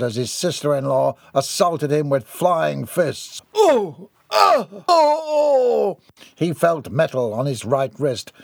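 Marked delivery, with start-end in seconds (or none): 3.5-6.0 high, loud yelps under blows; cries of pain. A narrator's reading. Gerard assaulted with flying fists, cries of pain. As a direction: yelp with each punch, last one drawn out.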